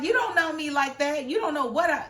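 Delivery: mock Tisha getting defensive. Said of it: "And Tisha's like".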